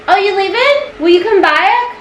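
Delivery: high sing-song pet voice; cooing to the cat to come back. Real, not acted. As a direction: coo up high at the kitty. Calling to the cat.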